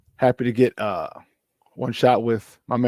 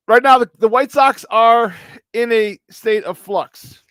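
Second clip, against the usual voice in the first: mock-dramatic announcement; 9 semitones higher, 6 dB louder, slower than usual. Real, not acted. Mock-dramatic sports commentary.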